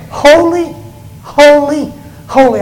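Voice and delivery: high, loud cry of holy; seraphim calling out. Acted. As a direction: call it out like an angel across the temple. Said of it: "one called out to another and said".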